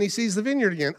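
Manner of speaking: normal talking voice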